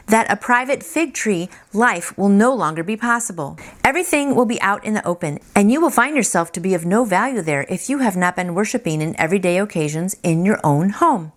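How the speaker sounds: usual speaking voice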